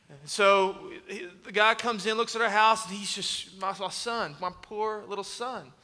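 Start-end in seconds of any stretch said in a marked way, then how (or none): none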